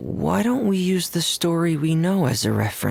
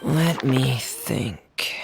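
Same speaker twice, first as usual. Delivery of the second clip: slow, breathy whisper to herself; whispered musing; much slower than usual. Acted. Traveler pondering the drawing in a slow whisper. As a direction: think aloud under your breath, slowly.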